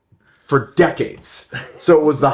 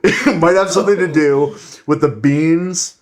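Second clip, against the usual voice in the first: laughing through it; 5 semitones higher, 4 dB louder than usual. Real, not acted. Cracking up mid-rant.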